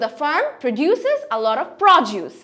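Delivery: slow, proDUces, then PROduce; contrasting stress. Shown as a demonstration. Teacher reads the example, proDUces versus PROduce. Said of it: "প্রটার উপরে আরো বেশি জোর".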